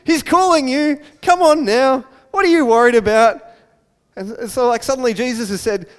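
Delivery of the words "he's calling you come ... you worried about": high, loud mock-cheery English voice; jolly along in hammed-up English style; very English crowd. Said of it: "It's very, very English".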